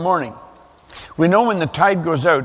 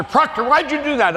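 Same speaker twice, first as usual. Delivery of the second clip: higher, hammed-up officer demanding an answer; commanding officer barking; 9 semitones higher than usual. Commanding officer grilling a sailor, mock demanding bark. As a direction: play the Navy officer calling him out. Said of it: "Every commanding officer".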